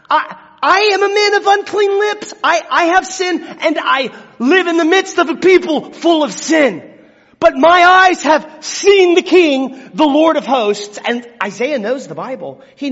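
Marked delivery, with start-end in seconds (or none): 0.1-11.0 much higher, louder, stammering cry; anguished confession. Acted. Isaiah confessing his sin before the King, anguished cry. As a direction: cry it out as a man undone. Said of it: "that's him acknowledging his sin".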